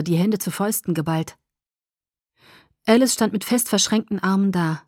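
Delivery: ordinary delivery, nothing marked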